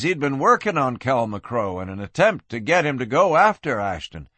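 ordinary delivery, nothing marked

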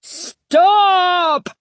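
very high, drawn-out cry; high frightened shriek. A narrator's reading. Stanley as scarecrows lurch forward, frightened shriek. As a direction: shriek it in panic. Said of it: "shrieked in a high, frightened voice".

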